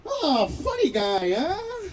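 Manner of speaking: mock him as the cop, drawing it out; sarcastic cop. Cop mocks the comedian.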